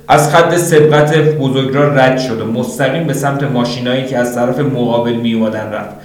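plain reading voice

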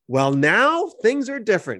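high, drawn-out, forceful "Well, now"; emphatic. Acted. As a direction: lean hard on "Well, now" to mark a big change. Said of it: "it's an emphatic one".

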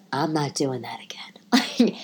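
a slow whispered vow; whispered aside. Real, not acted. Mother of two daughters swears off diaper duty in a whisper. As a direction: whisper it flatly, done with it.

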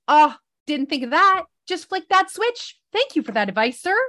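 high-pitched mock gratitude; sarcastic retort. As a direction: sarcastically thank someone for useless advice. Sarcastic retort to partner's advice.

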